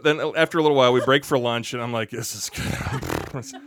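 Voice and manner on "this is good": drawn-out satisfaction. Retold or imitated. Slow satisfied aside.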